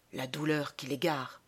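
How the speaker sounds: low, soft, sober remark; old gentleman concluding. Acted. Old gentleman excusing the raving mourner, low and soft. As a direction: say it quietly, pitying him. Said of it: "conclut le vieux monsieur".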